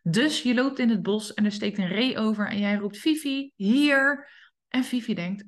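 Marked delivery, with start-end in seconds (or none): 3.0-4.3 a bright sing-song call to the dog. Shown as a demonstration. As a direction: call Fifi across the woods. Calling dog in forest.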